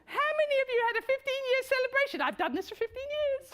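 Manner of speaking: teasing the audience, giddy; excited. Real, not acted. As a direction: rush it out giddily. Laughing question to audience.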